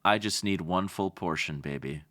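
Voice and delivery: low, soft crooning pick-up line; mock seductive line. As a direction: purr it like a hammed-up seducer. Flirty pickup line about love.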